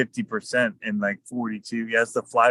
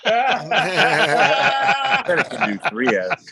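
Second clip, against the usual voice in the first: laughing; 11 semitones higher, 5 dB louder, much slower than usual. Real, not acted. Joking about the 3S battery.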